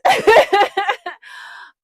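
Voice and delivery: big high laugh after the joke; real amused laugh; high loud laugh. Real, not acted. Laughs at her own joke.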